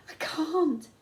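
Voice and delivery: high, loud, panicked refusal; a scared boy pleading he can't; terrified cry. A narrator's reading. Terrified boy at cliff edge.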